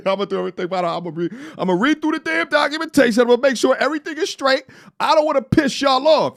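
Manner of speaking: ramp up, voice climbing high; dreaming aloud of a beach life, high and excited; worked-up rant. Real, not acted. Hyped rant, voice way up.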